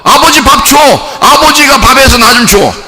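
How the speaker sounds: put-on child insisting dad feed him; demanding child. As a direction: nag your father like a kid. Child demanding food from father.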